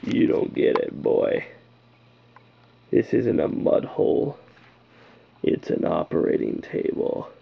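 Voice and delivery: low, slow whispered Batman threat; hushed menacing Batman voice; Batman's menacing line. Retold or imitated. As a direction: breathe it out like a cold threat mid-fight. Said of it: "he's like".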